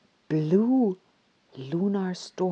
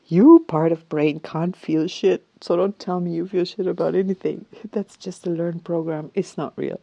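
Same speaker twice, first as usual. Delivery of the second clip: firm inner scolding; 8 dB louder than usual. Retold or imitated. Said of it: "And I'm thinking".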